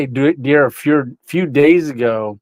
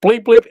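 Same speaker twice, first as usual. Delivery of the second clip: higher, louder beep beep like a lock chirp; imitated car beep; 8 semitones higher, 5 dB louder than usual. Mimicking the lock chirp behind his back, higher beep. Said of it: "I went".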